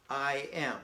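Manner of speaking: ordinary delivery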